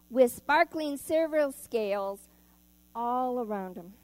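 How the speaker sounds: put-on storybook reading to kids in church; gentle storyteller voice; story narration. A narrator's reading. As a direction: read it out like a picture book for children. Reads picture book to children.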